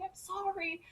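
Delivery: put-on high, soft child's apology; tearful-style apology. Lost child apologising to her mother, shaken.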